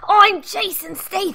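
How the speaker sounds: high, hammed-up impression; Jason Statham. Game master trying a celebrity voice for the dad, high and hammed-up. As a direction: push the voice up into a bad celebrity impression. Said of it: "try to do a Jason Statham voice".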